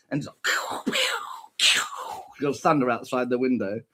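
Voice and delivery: usual speaking voice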